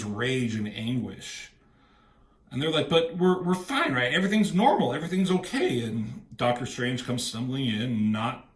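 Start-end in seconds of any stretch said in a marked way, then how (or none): 2.9-5.8 nervous insisting. Retold or imitated. Heroes insisting all is normal.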